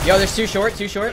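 loud, high urgent callout; excited callout. Real, not acted. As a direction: call it out fast and loud. Spotting enemies mid-round.

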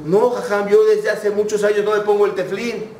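high, insistent refusal; emphatic denial. Retold or imitated. Driver emphatically declines the rabbi.

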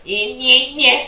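high, loud mock rabbit squeals; squeaky mock-rabbit yelping; rabbit noises. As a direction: imitate a rabbit in quick high yelps. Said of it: "and then, like, a rabbit".

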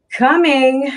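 high, singsong unhurried call; no sense of urgency. A narrator's reading. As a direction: call back loudly, in no hurry at all. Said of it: "says with no sense of urgency".

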